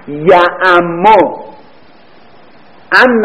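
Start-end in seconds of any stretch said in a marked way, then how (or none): 0.1-1.4 یعمو stretched very slowly, long vowel and doubled م; drawn-out model form. Shown as a demonstration. Teacher to class, modelling the form slowly. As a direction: spell out یعمو slowly for students. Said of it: "بگیم از ام".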